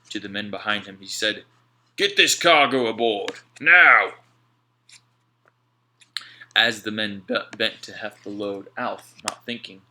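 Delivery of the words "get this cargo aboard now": much louder, rising to a shout on now; Slank barking orders. A narrator's reading. Slank ordering his men, loud shouted command. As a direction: bark the order.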